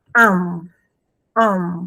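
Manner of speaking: "am" as a nasal vowel, sounded out for the class; nasal vowel demo. Shown as a demonstration. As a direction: hold the "am" sound nasal and slow. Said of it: "Va a sonar".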